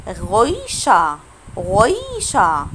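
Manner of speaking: غويشة very slow, throaty first R, ending in open "a"; silent final R. Shown as a demonstration. Teacher models German word with final R unpronounced, slow. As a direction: stretch غويشة as "ghoyyy-sha". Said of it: "المفروض ما ننطقهوش".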